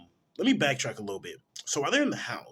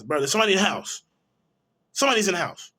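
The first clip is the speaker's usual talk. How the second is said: emphatic insistence; 7 semitones higher, 9 dB louder, much faster than usual. Pretend. Insisting someone is inside.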